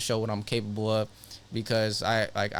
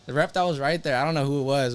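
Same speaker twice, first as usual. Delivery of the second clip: ordinary delivery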